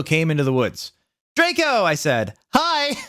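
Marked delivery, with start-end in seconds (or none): 1.4-1.8 very high squeal of a name; excited greeting. Acted. Greeting her boyfriend. 2.5-3.0 very high chirpy hi; girly hi. Acted. Greeting Draco.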